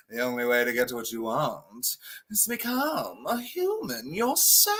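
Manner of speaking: a hint of a hammed-up sea witch; lightly voiced Ursula. Retelling an Ursula number, in a light mock villain voice. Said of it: "they're like".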